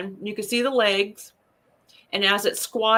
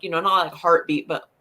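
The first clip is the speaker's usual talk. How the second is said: ordinary talk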